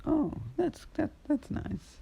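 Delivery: high, slow, hesitant mum voice; a mother's unsure reply to her son. Acted. Mother gently answering her son, high and hesitant.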